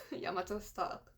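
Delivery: breathless unvoiced whisper. Real, not acted. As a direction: whisper it, overwhelmed. Overwhelmed by emotion.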